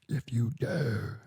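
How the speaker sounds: breathless whisper, dragged out slowly; breathless call-out. Retold or imitated. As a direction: gasp out the challenge in a whisper, totally spent. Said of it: "like, out of breath".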